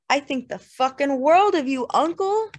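high, loud emotional outburst; drunken wail. A narrator's reading. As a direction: gush unhappily, climbing at the end. Drunk King wailing to his uncle.

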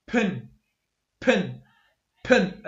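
pin with a strong puff of air after the p; aspirated pin demo. Shown as a demonstration. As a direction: blow a burst of air out on the p of pin. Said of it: "مثلًا أقول".

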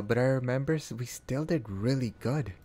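usual speaking voice

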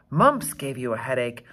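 ordinary delivery, nothing marked

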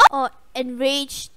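ordinary talk, nothing marked

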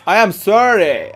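slow, put-on English apology; drawn-out fake sorry; hammed-up English sorry; mock apology. Mock apology after hitting someone.

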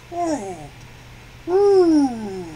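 normal talking voice

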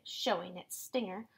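usual speaking voice

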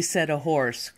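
ordinary delivery, nothing marked

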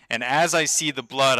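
ordinary delivery

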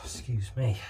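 ordinary delivery

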